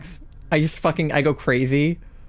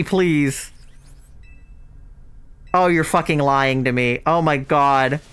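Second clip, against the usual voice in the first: ordinary talk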